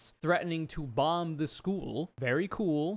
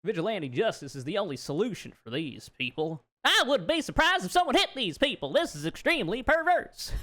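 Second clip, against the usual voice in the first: put-on Southern accent voice; 5 semitones higher than usual. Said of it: "I also like to do the Kate Koner voice".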